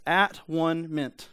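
"At one meant" said very slowly, as three separate words; split into parts. Shown as a demonstration. Preacher breaking a word down for the congregation, "At one meant" slowly separated. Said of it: "you can just break down into three".

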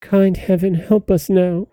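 high, understated plea; a touch of despair in a little girl's prayer; crying out in distress. A narrator's reading. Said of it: "she cried".

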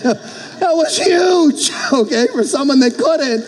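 high, drawn-out exclamation with a laugh; excited exclamation. Real, not acted. Preacher celebrates swimming a mile without stopping, excited exclamation. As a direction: burst out high, proud and laughing. Recalls his own swimming triumph.

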